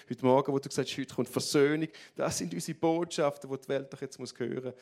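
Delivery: ordinary talk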